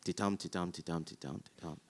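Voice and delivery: soft da-DUM rhythm, fading away; iambic beat. Shown as a demonstration. Chanting the iambic beat to the audience, softly. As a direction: tap out the iambic stress on ti-tum, then fade. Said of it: "If it's iambic".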